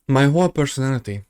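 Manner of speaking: ordinary delivery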